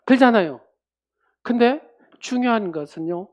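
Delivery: usual speaking voice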